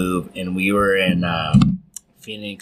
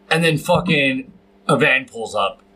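ordinary delivery

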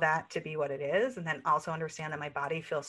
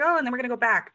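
mocking quote; 6 semitones higher, 9 dB louder, much faster than usual. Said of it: "it's like".

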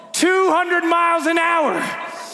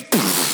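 sharp burst of air through the lips; imitated smack. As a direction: puff out a mock impact noise. Imitates hand smacking face.

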